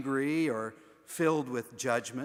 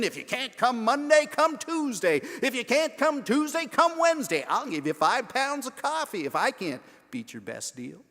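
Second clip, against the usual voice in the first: much higher and louder, a hard-sell announcer shouting the deal; pushy radio ad; 9 semitones higher, 9 dB louder than usual. Retold or imitated. Preacher mocks a radio ad screaming for attention, loud hard sell. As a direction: yell it like a cheesy car-lot commercial. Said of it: "a radio ad screaming for our attention".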